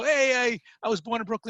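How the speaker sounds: high, loud proud call to the guy; excited shout back at the Brooklyn guy. Retold or imitated. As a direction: call out proudly while jogging. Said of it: "I go".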